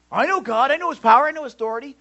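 high, loud mock insistence; mock boastful believer. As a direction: parody someone proudly insisting on their faith. Mimicking boastful unsaved believer.

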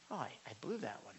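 quiet quick admission of failure; rueful aside. Retold or imitated. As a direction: mutter it like a realization. Retold self-realization.

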